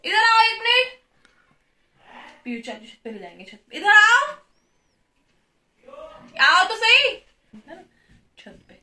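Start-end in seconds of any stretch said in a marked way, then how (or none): 0.0-1.0 loud, high call across the house; yelling across the room. Real, not acted. Calling Piyush over. 3.8-7.2 yelling across the room. Real, not acted. Calling Piyush over.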